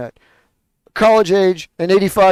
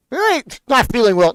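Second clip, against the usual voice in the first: mocking retort; 6 semitones higher than usual. Mocking the excuse.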